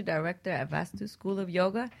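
ordinary talk

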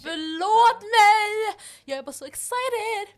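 high, loud, drawn-out outburst; excited apology. Real, not acted. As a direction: cry out an over-the-top apology. Mock apology for interrupting excitedly.

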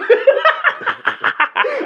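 real high-pitched cackle; long, high peal of laughter; high burst of laughter. Real, not acted. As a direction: crack up helplessly. Others crack up at his slip.

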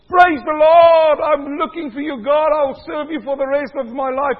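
high, loud mock-pious baby voice; mock pious newborn. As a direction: ham up a newborn praising the Lord. Mock newborn praising God.

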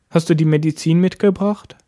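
ordinary talk

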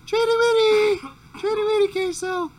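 very high loud sing-song call; high-pitched dog call at night. Retold or imitated. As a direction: squeal it like calling a pet. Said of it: "you gotta do it in a high-pitched voice".

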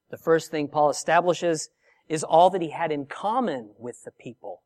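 usual speaking voice

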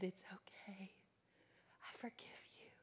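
soft reassuring whisper; tender whisper. Retold or imitated. Preacher reassuring the weeping inmate in a tender whisper. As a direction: comfort her gently, barely voiced.

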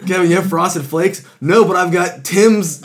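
loud, high hammed-up question and answer; mock cereal exchange. Mocking Kevin's off-brand cereal, hammed-up dialogue.

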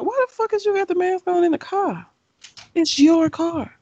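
high, baffled mock rant at drivers; exasperated, disbelieving question; incredulous. Talking about drivers masked alone in their car, incredulous.

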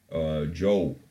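lightly voiced Obama pause; a hint of measured Obama drawl; Obama impression. Host trying Bernie's voice to Rogan, slipping into a light Obama. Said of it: "No, that's, that's Obama".

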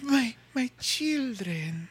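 slow, soft mock-tearful wail; hammed-up weepy plea, drawn out; mock crying. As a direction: draw it out like a tearful father. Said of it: "He's crying".

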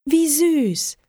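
high, bright little cry of delight; girl gushing over cute kittens, delighted; delighted squeal. A narrator's reading. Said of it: "sagte Isabella".

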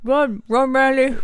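loud call to flee; urgent shout. Acted. Kid fleeing the shop with a friend, urgent shout. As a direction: yell for your friend to bolt.

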